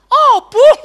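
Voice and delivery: put-on high, loud, childish answer; small child blaming. Toddler blames grandma for the broken vase, high and childish. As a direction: chirp it out like a toddler pointing at grandma. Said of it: "siapa yang pecahin?".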